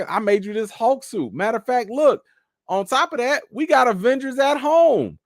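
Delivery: high-pitched, hammed-up mom voice; mama, imitated. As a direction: play a mom eagerly selling her homemade alternative. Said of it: "of course, her mama comes in".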